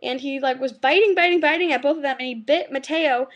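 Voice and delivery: ordinary delivery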